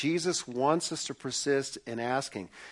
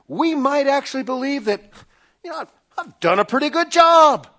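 loud, high mock British accent; a pompous British gentleman, pitched up; 9 semitones higher, 9 dB louder than usual. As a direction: play a smug British chap, high and loud. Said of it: "speak in a British accent with a sport coat".

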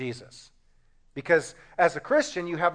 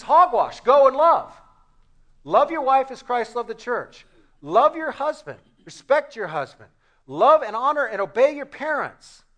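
louder, higher, firm and commanding; stern command; 7 semitones higher, 6 dB louder than usual. Acted. Jesus rebuking the whining believer, loud and firm. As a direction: bark it out as a no-nonsense rebuke. Jesus answers the complaining prayer.

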